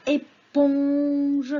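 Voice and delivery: "éponge" very slow, "pon" held long; sounding out. Shown as a demonstration. Teacher lets children hear the syllable, sounding it out. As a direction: stretch "éponge" loudly so "pon" stands out.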